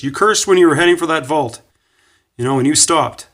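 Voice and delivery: high, loud retold telling-off; angry accusation. Coach insists gymnast cursed at the vault, angry. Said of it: "he sounded pretty angry".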